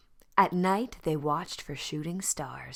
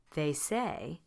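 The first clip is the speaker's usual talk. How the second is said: usual speaking voice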